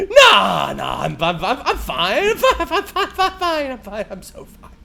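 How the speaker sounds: repeated insistence dissolving into laughs; fading laughing I'm fine; nervous laughing denial. Retold or imitated. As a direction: protest too much, breaking up. Denying obvious fear.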